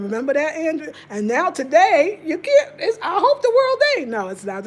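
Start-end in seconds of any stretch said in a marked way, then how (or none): 2.2-4.1 high, loud, half-laughing fake wail; joke-cry it high and loud; playful mock panic. Teasing Andrew about the world ending, mock-panicked high wail. Just playing.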